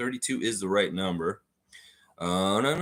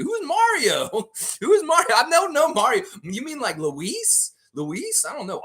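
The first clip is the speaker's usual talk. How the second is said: high, loud incredulous who is Mario; playfully baffled by the guess; squeaky disbelief at chat; mock baffled; 14 semitones higher, 3 dB louder than usual. Real, not acted.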